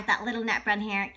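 usual speaking voice

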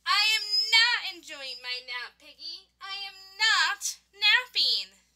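testy, deliberate complaint; exasperated denial. Acted. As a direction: stress each "not", fed up. Annoyed character denies napping.

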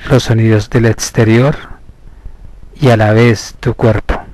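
slow, measured hypnosis voice with long pauses; guided meditation. Acted. Therapist leading listeners into hypnosis through slow breathing, measured meditation voice. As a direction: guide them inward, stretching the counts and key words.

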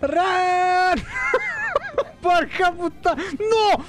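high frantic yell; panicked shout. Real, not acted. Chased by the Nemesis, panicked shout. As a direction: shout run at yourself in panic.

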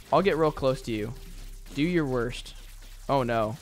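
ordinary talk, nothing marked

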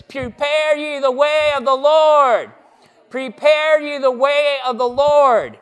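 "Prepare ye the way of the Lord" shouted high and loud, each word called out; loud proclamation. Shown as a demonstration. Pastor marching with children down the aisle, loud prophetic call. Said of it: "see how much louder that is".

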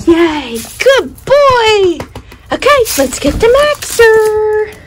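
high, sing-out pet voice; excited dog praise. Real, not acted. Dog mom rewarding her dog before fetching the other, excited. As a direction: cheer the dog on in a lifted coo.

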